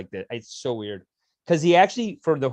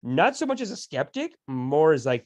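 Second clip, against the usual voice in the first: ordinary delivery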